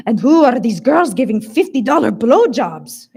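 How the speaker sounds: put-on loud Hungarian-accented disbelief; bewildered, Hungarian accent. A narrator's reading. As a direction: demand it, baffled, in a thick Hungarian accent.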